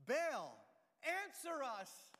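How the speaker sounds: loud desperate cry to Baal; shouting. Acted. Prophets crying out to Baal, loud and slow. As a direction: call out, dragging it slow. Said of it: "they shouted".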